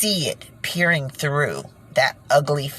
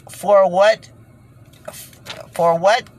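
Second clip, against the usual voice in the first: indignant demand; 5 semitones higher, 5 dB louder, slower than usual. Real, not acted. Demanding answer from Hillary.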